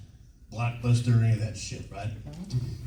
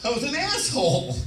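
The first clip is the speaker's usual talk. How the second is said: mock contrition. Actor owning up to skipping the screening, high mock contrition. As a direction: squeal your admission over the audience's reaction. Mock confession after audience groans.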